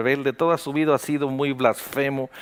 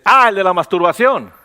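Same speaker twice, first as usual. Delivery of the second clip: a loud, higher exclaimed reply; shouted; 5 semitones higher, 7 dB louder than usual. Retold or imitated. Pastor recalling how he shouted the topic back, loud. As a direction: call it out loud in mock surprise. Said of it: "Y yo grité".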